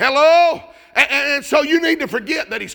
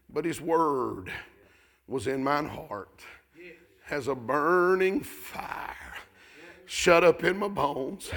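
low, soft, slow reverent quote; soft slow solemn reading; hushed scripture; 7 semitones lower, 8 dB quieter, slower than usual. Acted. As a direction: hold back, let each phrase land slowly. Quoting Jeremiah softly.

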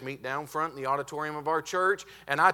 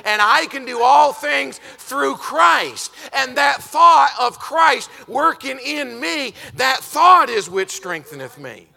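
preaching at full force; 7 semitones higher, 13 dB louder than usual. Acted. Preacher shouting the text to his church at full force. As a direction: thunder the verse at the congregation.

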